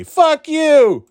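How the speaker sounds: high, loud comeback; mock outrage. Player cursing back at his online attacker, indignant. Acted retort to the shooter.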